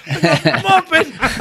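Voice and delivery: high, drawn-out comic retort; mock outraged retort. Young man answering the guard's insult, mock outraged. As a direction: squeal back the insult, half laughing.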